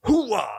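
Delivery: high, loud drawn-out whoop; big showy high holler; shouted hooah. Pretend. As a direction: let out a long battle-cry whoop. Shouted signal to start the segment.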